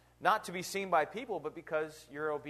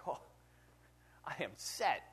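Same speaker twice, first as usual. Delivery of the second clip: smug whisper; 6 dB quieter than usual. Retold or imitated. Rich man content with his crop.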